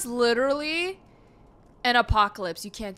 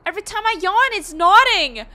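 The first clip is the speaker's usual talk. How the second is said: high, loud, astonished outburst; amazed; 7 semitones higher, 9 dB louder than usual. Real, not acted. Gamer to viewers as camera nods at cat, amazed. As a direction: exclaim it in raised-voice wonder.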